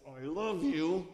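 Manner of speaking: slow, strained, reluctant I love you; a half-hearted bedtime I love you after a fight; grudging. Shown as a demonstration. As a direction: say it through clenched feelings, dragging it out. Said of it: "we say it like this".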